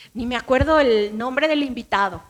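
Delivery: ordinary talk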